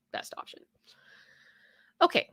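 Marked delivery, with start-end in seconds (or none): 0.1-0.6 whispered echo to herself. Real, not acted. Repeats phrase, second time whispered.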